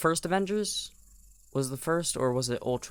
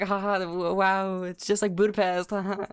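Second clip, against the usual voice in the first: mock flippant laugh; 4 semitones higher than usual. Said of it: "you're just like".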